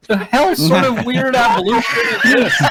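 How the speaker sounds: laughing shout. Real, not acted. Laughing outburst at the absurd plot.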